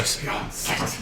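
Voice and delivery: breathy, mysterious hush; dramatic whisper. Pretend. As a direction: whisper the spell name like an incantation. Mock spell name.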